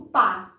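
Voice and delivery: "pá" punched as a single open beat; stressed syllable. Shown as a demonstration. Teacher isolates the strong syllable, stressing "pá". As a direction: hit "pá" firmly. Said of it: "som mais forte é no pá".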